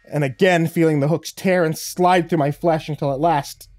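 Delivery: loud, strained with pain; straining effort. A narrator's reading. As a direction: push through agony, full force. Tearing free from hooks.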